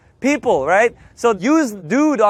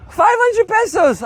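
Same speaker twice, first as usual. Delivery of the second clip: very high, drawn-out comic protest; hammed-up high disbelief; mock outrage; 10 semitones higher than usual. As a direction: wail the price in fake despair.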